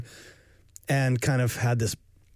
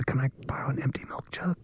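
whisper; 5 dB quieter than usual. Pretend. Thief whisper.

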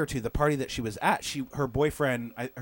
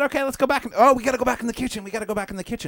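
high, quick urgent "gotta go back"; mock urgency, pulling a friend away; urgent retold hurry; 8 semitones higher, much faster than usual. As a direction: hustle her out fast, higher. Acting urgent line to leave.

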